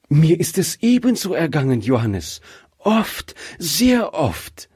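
eager interruption. Acted. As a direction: burst in, pitch rising. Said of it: "unterbrach ihn seine Frau".

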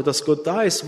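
normal talking voice

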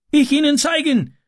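very high, eager cry; calling out. A narrator's reading. Boy offers to show the man, calling out. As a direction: call up on a high, held pitch. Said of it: "rief Ram".

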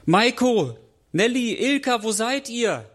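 high, carrying call; searching call. A narrator's reading. Boy looking for friends at dusk, searching call.

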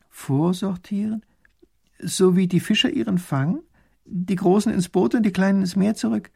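high, naive questioning; puzzled. A narrator's reading. Fisherwoman queries the minister's word, puzzled.

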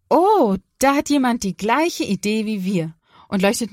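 high, loud "Oh", then lively; delighted discovery. Acted. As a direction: exclaim at a happy discovery. Father points out a light. (freudig überrascht ausrufen)

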